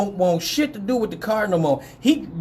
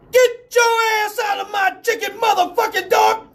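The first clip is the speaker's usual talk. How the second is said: high-pitched outraged holler; outraged yelling; 3 dB louder than usual. Retold or imitated. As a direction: yell at the dog to back off. Stinger confronts dog over his chicken.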